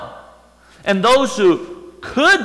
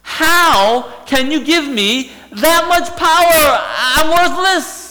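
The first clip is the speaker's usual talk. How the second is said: awed, pleading woman's voice, louder and higher; voicing the woman; 6 semitones higher, 4 dB louder than usual. Samaritan woman to Jesus, stunned and pleading. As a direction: let her disbelief spill out.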